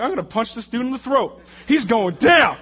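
loud, high angry muttering to himself, building to a shout; comic fighting talk; mad threat. Retold or imitated. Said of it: "I'm mad".

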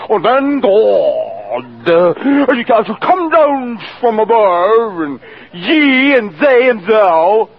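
high, drawn-out, booming mock pulpit oratory; pulpit preacher voice. Pastors behind the pulpit calling on God, mock preacher voice. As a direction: intone grandly, stretching every word, hammed-up. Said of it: "their voice would change".

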